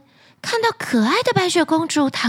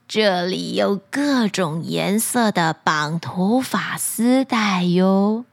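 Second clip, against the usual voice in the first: put-on old crone voice; 5 semitones lower, slower than usual. Old woman answers.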